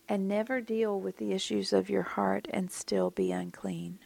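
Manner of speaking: hushed, breathy warning whisper. Acted. Preacher warns the congregation in a whisper.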